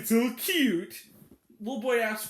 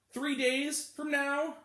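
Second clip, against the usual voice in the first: drawn out; slower than usual. Shown as a demonstration. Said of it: "stretched then repeated".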